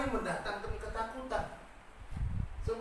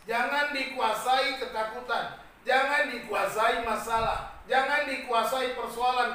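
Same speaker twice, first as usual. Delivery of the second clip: slow exhortation; 5 dB louder, slower than usual. Acted. Sermon exhortation, slow and punched.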